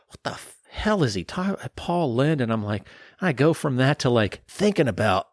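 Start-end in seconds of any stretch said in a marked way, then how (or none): 0.1-2.4 baffled. Retold or imitated.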